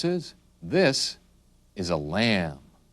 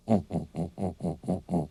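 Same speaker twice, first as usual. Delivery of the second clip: softer string of short, even hoots; gorilla grunts; 4 dB quieter than usual. Shown as a demonstration. Teacher presenting a gorilla, soft ape grunts. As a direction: grunt softly in rhythm like a gorilla.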